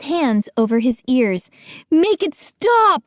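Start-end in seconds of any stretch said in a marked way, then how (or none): 1.9-3.0 high, slow, panicked plea; panicked scream. A narrator's reading. Gates covers his ears, pleading, panicked. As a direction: beg it to stop in panic. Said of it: "he screamed".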